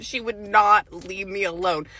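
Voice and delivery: normal talking voice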